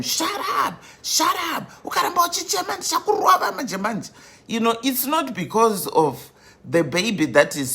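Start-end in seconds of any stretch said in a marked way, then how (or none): 0.0-4.1 mock shouting mother. Mother at the grocery store to screaming babies, mock shouting. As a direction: act out a scolding mother, pitched way up. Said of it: "she's shouting at them".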